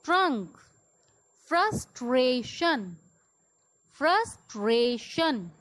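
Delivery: words like "ब्रिज" alone, clusters blended; model pronunciation. Shown as a demonstration. Teacher leading repeat after me, isolated clear words like "ब्राउन". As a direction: say "क्राउन", "फ्रस्ट्रेशन" separately, pauses between. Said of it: "repeat after me".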